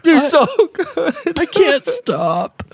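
high, slow, laughing glutton voice; binge eater. Acted. A person with an eating disorder, voiced high and slow. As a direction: squeal it out like someone stuffing their face.

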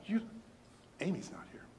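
unvoiced confiding whisper; conspiratorial hushed aside. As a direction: whisper it like a secret.

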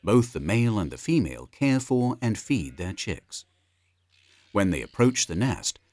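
ordinary talk

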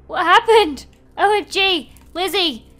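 high, loud, astonished cries; shocked exclamations. Acted. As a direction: cry out in disbelief. Trapped friend found, both shocked.

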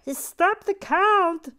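high, slow, loud mock wail; hammed-up loud protest. To a viewer mentioning the Count, a hammed-up slow wail. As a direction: stretch it out like a theatrical plea.

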